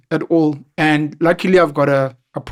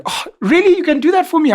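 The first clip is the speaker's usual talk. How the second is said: mock delighted girl; 13 semitones higher, 4 dB louder than usual.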